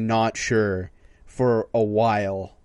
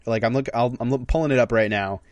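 ordinary talk

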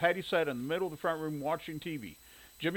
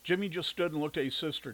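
usual speaking voice

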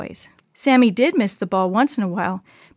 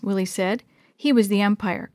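usual speaking voice

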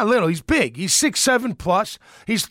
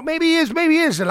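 mock wishy-washy pundit; 7 semitones higher than usual. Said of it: "everyone just start".

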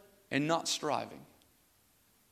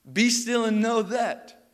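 slow preached build; 7 semitones higher, 7 dB louder, slower than usual. Acted. Preaching the verse, rising intensity.

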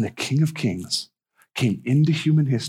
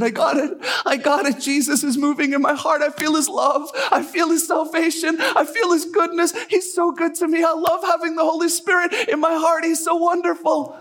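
high-pitched ecstatic outpouring; overjoyed. Retold or imitated. Young lady back from praying, tears in her eyes, overjoyed. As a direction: gush in acted joy, pitch climbing and staying high. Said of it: "she's so happy and said".

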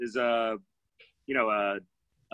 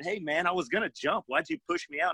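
higher, softer mild complaint; retold protest; 5 semitones higher, 5 dB quieter than usual. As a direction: protest lightly, pitch climbing at the end. Retold protest to the pushing instructor.

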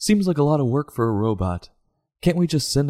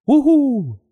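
high, loud whoop of victory; cheering; 6 dB louder than usual. Real, not acted. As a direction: shout it like you just won. Rover fixed, mission accomplished.

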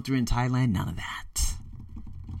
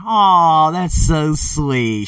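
drawn-out, louder teasing "aw"; mock cooing; 4 semitones higher, 8 dB louder, much slower than usual. Teasing himself over the memories, mock cooing. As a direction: coo it with exaggerated sweetness. Mocks a cooing audience reaction.

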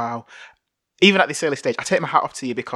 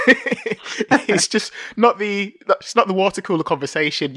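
words breaking into real laughter, slowing right down; laughing; 7 semitones higher, slower than usual. Real, not acted. Podcast host laughing at his guest's talk of guns and bombs.

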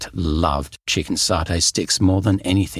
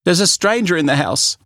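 alarmed; 10 semitones higher, 3 dB louder than usual. Acted. Child alarmed at stranger.

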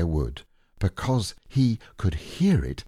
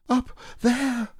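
Piglet voice. Acted.

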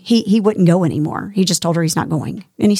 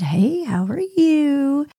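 high, slow, drawn-out hello; sing-song greeting; 7 semitones higher, much slower than usual. Retold or imitated. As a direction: stretch the greeting out brightly. Her own greeting retold.

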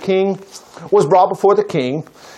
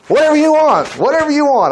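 high, expansive offer; a king giving anything asked, much higher; delighted granting voice; generous king; 8 semitones higher than usual. Acted. King grants anything, preacher excited.

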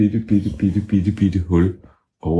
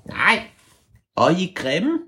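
very high squeaky creature voice; tiny high creature voice; ævle bævle voice; 11 semitones higher than usual. Acted. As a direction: squeak back like a cheeky hidden creature.

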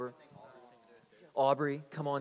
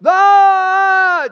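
long, very high, very loud yell; mock driver's scream; 25 dB louder than usual. Driver pretending to crash as chaperone panics, long mock scream. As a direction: hold a wild full-volume "Ah!". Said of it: "he starts going".

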